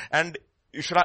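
ordinary delivery

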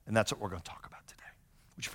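soft whisper into prayer. Real, not acted. Pastor inviting the congregation to pray, whispering. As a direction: hush the room before praying.

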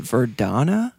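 "Verdana" said ver-DAH-na. Shown as a demonstration. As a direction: say "Verdana" with a broad AH in the stressed middle. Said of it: "if you pronounce it".

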